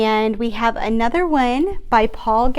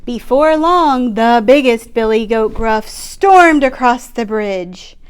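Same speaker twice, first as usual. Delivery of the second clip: ordinary delivery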